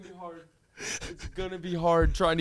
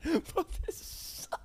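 wheezing laugh; 12 semitones higher, 9 dB quieter, much slower than usual. Real, not acted. Wheezing laughter after long fit.

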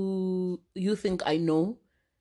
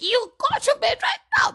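high mock scolding order, louder; bossy older cousin; 5 dB louder than usual. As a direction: mimic herself ordering the kids to bed. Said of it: "I'm like".

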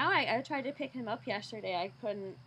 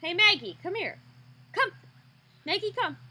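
calling the dog; 7 semitones higher, 7 dB louder than usual. Real, not acted.

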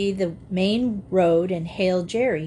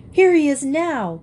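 higher, louder burst of excitement; excited cry; 7 semitones higher, 5 dB louder than usual. A narrator's reading. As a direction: call it out eagerly to the others. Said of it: "cried Dan, waving excitedly".

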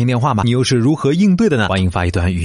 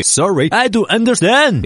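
fake foreigner; 10 semitones higher, slower than usual. Fakes foreign speaker to scammer.

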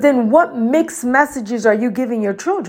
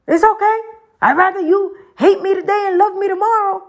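put-on high, raised mother's voice telling her kids; retold words to kids; 10 semitones higher than usual. Mother telling her upset children, high and raised. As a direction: mom firmly but lovingly telling her kids. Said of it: "when I used to tell my children".

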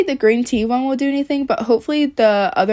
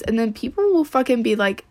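ordinary delivery

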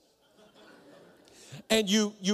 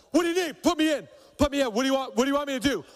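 intense bark; 7 semitones higher, much faster than usual. Retold or imitated. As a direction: fire the questions like a linebacker ready to go. Intense Heath demanding tasks.

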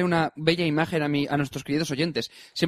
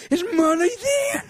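mock inner whine; 13 semitones higher, much slower than usual. Retold inner thought.

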